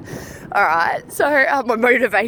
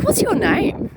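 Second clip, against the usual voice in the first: asking a ghost's name, pitched higher; higher, pointed question to the ghost; higher demanding question; 11 semitones higher, 4 dB louder, slower than usual. Retold or imitated. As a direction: demand it sharply, voice up high.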